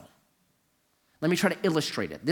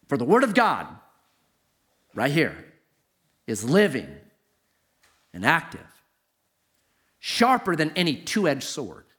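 scripture reading. Acted. Pastor reads Hebrews to the congregation, solemn and weighty. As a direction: let the verse land, dropping to a hush at the end.

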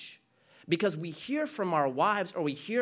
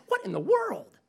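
Jesus protesting; 9 semitones higher, faster than usual. Pretend. Pastor voicing Jesus denying he is passive aggressive, indignant. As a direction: voice Jesus as baffled and affronted. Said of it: "So he's like".

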